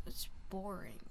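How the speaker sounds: low, soft, trailing grumble; bored complaint. Real, not acted. Student grumbling about school without drama, quiet bored complaint. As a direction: let it fade almost to a whisper.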